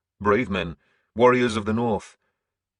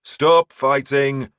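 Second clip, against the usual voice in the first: loud, much higher, slow commanding shout; 7 semitones higher, 9 dB louder, much slower than usual. A narrator's reading. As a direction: stretch each word out over the fight. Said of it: "my turn to shout".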